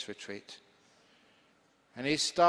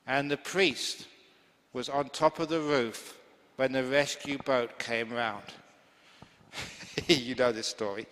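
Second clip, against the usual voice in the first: ordinary talk, nothing marked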